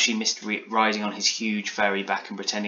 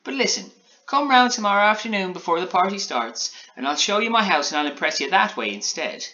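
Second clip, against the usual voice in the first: high-pitched gingerbread man; Alan Taylor; 10 semitones higher, 4 dB louder than usual. A narrator's reading. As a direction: coax eagerly in a raised character voice. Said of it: "replied Alan Taylor".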